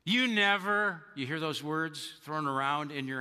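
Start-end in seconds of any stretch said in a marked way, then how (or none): 0.0-1.0 raised, drawn-out mock complaint; a hint of a fed-up spouse, higher and louder; mock frustrated accusation. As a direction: act out a frustrated spouse snapping. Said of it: "you're going".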